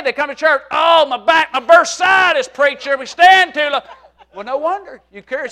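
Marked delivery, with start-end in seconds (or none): 0.7-3.8 loud, hammed-up aching groaning; mock complaining. Church lady complains to preacher about standing, hammed-up whine. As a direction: wail about aches to get out of standing. Acts church lady's aches.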